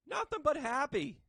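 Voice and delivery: high, loud, slowed cheer; a big happy cheer to the viewers; excited shout. Acted. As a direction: belt it out with joy. Repeated, much louder and higher.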